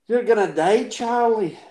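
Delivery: Desmond impression. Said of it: "pivot into a Desmond impression".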